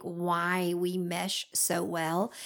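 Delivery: ordinary talk, nothing marked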